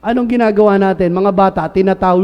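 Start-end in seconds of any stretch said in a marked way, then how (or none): none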